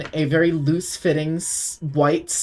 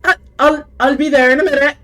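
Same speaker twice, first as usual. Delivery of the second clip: voice from inside; 10 semitones higher, 10 dB louder, faster than usual. Acted. Deirdre calling from inside the house to the door. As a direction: hurry the reply from another room. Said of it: "you hear a different voice go".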